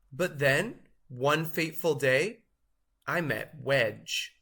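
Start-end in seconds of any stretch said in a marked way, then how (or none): none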